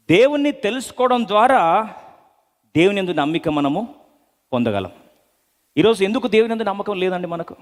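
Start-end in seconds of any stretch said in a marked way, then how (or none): none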